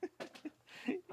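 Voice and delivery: squeaky high laugh; high giggle. Real, not acted. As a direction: giggle at the puppy. Laughs at puppy.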